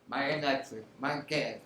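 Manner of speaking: usual speaking voice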